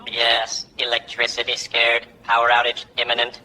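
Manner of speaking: talking parrot. Acted. Parrot answering the vain patient, low deadpan voice. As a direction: give the verdict like a low robotic bird.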